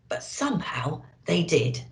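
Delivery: ordinary delivery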